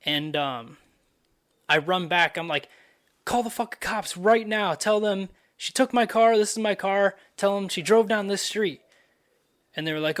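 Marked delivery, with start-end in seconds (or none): 3.2-8.8 high, loud retold alarm; urgent command. Man runs back to have cops called, urgent command. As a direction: rattle out orders as if reliving the emergency.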